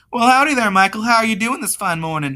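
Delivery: put-on high, hearty southern-accented greeting; friendly southern accent. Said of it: "in his friendly southern accent".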